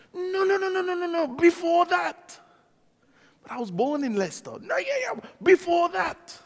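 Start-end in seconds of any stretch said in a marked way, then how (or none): 0.1-2.4 high, insistent no no no; pressing him on where he's from; insistent questioner. Retold or imitated. A local pressing him, high and insistent. 4.6-6.4 high, insistent no no no; pressing him on where he's from; insistent questioner. Retold or imitated. A local pressing him, high and insistent.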